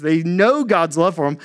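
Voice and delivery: usual speaking voice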